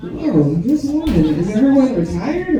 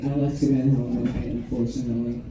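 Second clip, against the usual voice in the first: quiet, sad repeat; 7 semitones lower, 6 dB quieter than usual. Shown as a demonstration. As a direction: let it drop, sadly. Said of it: "So I left him at home tonight".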